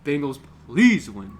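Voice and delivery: slow plea, leaning hard on "please"; pleading stress on please. Real, not acted. Fan begs the Bengals to win, stressed pleading "please".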